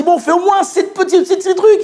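high, insistent imitation of a father; exasperated parent pleading. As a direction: plead with a stubborn child, pitched high. Father pleading with reluctant child. (père exaspéré qui insiste)

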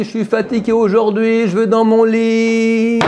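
a sleepy pupil begging off his study; lazy tired student. Acted. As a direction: stretch every word like a worn-out student who wants his bed.

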